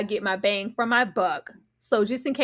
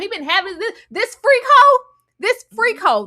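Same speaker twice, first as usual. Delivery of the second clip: outraged exclamation; 10 semitones higher, 7 dB louder than usual. Real, not acted. Outraged commentary.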